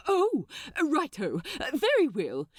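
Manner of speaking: lightly voiced, flustered angel; startled into agreeing; flustered Aziraphale. Aziraphale snaps out of spell.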